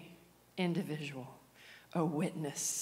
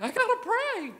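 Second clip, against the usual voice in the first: urgent shout; 10 dB louder than usual. Retold or imitated. Insisting over the crowd.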